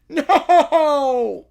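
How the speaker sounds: a giggling, loud no; laughing no. Real, not acted.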